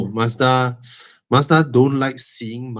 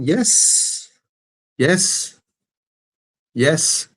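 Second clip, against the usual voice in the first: emphatic yes; 5 semitones higher, much slower than usual. Real, not acted. Emphatic answer to viewer question.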